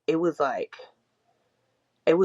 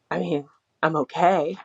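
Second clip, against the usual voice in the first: ordinary talk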